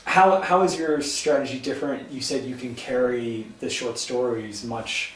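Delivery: normal talking voice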